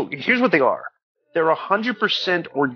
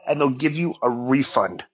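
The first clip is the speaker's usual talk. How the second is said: usual speaking voice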